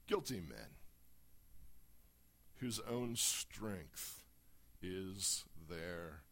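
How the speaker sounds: solemn, declaiming reading; dramatic scripture reading. Acted. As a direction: read the prophecy with gathering dread.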